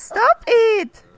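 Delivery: slow, high, loud stop it; loud scold. Acted. Player ordering Cruella to stop feeding the puppy, loud. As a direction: bark the order at the dog feeder.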